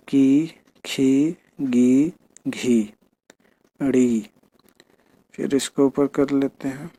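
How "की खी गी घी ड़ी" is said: each syllable drawn out slowly on its own; slow syllable reading. Shown as a demonstration. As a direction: read the syllables one by one slowly for the pupils. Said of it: "एक बार ऐसे भी पढ़ लेना". (धीरे-धीरे एक-एक अक्षर पढ़ना)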